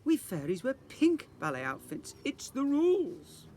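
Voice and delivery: prim teacher insisting on the rules; strict fairy teacher. Acted. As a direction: lay down the law firmly.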